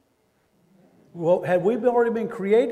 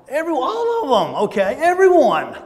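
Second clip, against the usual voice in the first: high, loud joyful cry of everyone; excited exclaim; 8 semitones higher, 8 dB louder than usual. Real, not acted. Preacher to congregation, rising loud and excited. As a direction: ring out everyone with delight.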